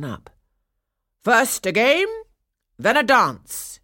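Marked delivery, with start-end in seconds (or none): 1.2-3.8 loud, high announcement to a room; calling out. A narrator's reading. Councillor organising the children at the party, calling out. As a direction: call it out loudly, lifting then dropping. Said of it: "he called out".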